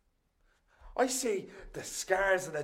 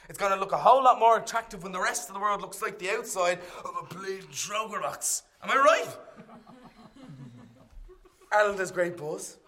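ordinary delivery

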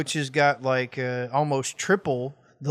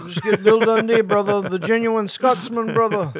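louder, higher mock wrestler voice; put-on promo voice; 6 semitones higher, 5 dB louder than usual. Teasing co-host about Scottish accents, loud put-on voice. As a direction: punch out "brother" like a wrestler.

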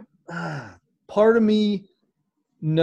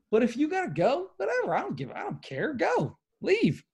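dismissive; 10 semitones higher, faster than usual. Real, not acted. Dismissive shooing of early leavers.

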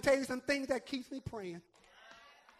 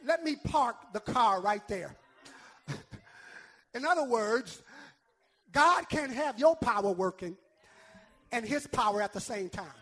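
loud, rhythmic pulpit delivery; punchy shouted sermon cadence; full-force preaching; 6 dB louder than usual. Acted. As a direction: hammer each phrase home to the congregation.

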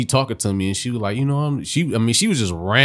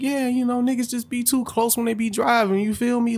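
higher, casual imitation of her; imitating the caller excusing her driving, lightly voiced; lightly voiced New York woman; 12 semitones higher than usual. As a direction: mimic her shrugging it off. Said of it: "She was like".

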